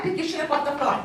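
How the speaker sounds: normal talking voice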